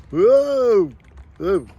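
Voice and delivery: loud startled whoa while spinning; dizzy whoa. Real, not acted. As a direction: yelp as the chair whips round. Dizzy swinging around.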